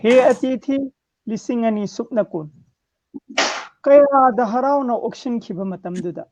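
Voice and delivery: measured declamation in separate phrases; strong line openings, falling cadence; poem recitation. Acted. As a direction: recite verse line by line with pauses. Recites own poem on request.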